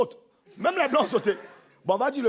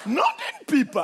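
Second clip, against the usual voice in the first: high-pitched exclamation of surprise; mock astonishment; 10 semitones higher, much slower than usual. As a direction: squeal it as an astonished woman. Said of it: "quand elles sont étonnées". (stupéfaction surjouée, voix aiguë)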